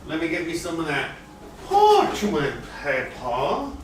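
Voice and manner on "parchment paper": "parchment paper" stretched out very slowly in a Southern drawl; lightly Southern, slow drawl. Shown as a demonstration. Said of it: "speak like that with a southern drawl".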